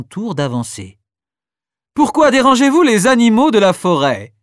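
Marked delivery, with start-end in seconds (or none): 1.9-4.3 cerf majestueux, plus fort et plus aigu, hautain. Acted. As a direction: questionne de haut, avec dédain. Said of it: "questionne-t-il d'un air hautain". (un cerf majestueux interroge les enfants, d'un air hautain)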